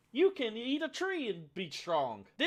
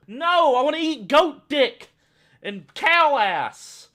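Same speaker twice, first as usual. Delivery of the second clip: loud, higher and slow yelled reply; furious shout; 8 semitones higher, 15 dB louder, much slower than usual. Acted. Snapping back at a pushy vegan, loud shout. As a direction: blast it back at them.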